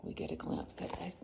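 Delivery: fast hushed whisper; hushed spooky whisper; suspense whisper. A narrator's reading.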